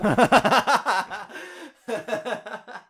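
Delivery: high, loud laughing fit; loud burst of laughter. Real, not acted. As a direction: crack up out loud. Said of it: "j'ai pas compris pourquoi l'accent québécois".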